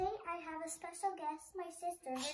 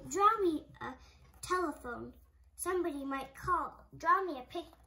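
loud, bossy monster; loud bossy monster demands; demanding monster; 2 semitones higher, 8 dB louder than usual. Acted. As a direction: boss him around loudly.

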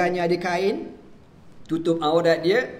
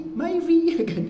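mock dismay; 13 semitones higher, 3 dB louder, slower than usual. Jokes about the Myvi.

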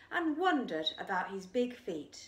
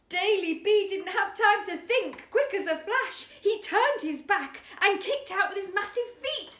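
loud, high, thrilled storytelling; excited narration; 10 semitones higher, 8 dB louder than usual. A narrator's reading. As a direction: build the climax with excitement. Exciting climax narrated loudly.

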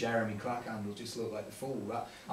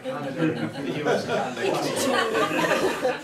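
laughing; 11 semitones higher, 8 dB louder, slower than usual. Retold or imitated. Retold own joking remark, laughing.

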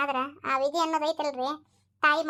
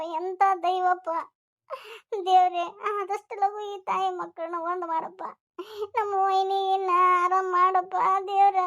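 slow, wailing lament; tearful prayer; 3 semitones higher than usual. Acted. Woman begs God to reunite mother and son, lamenting. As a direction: cry out to God, stretching each phrase.

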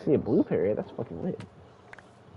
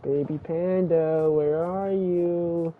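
slow, coaxing sing-out with long vowels; drawn-out calling; much slower than usual. Real, not acted. Player searching for a baby panda, drawn-out calling. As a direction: call out slowly, stretching each word.

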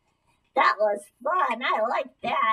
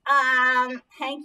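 normal talking voice

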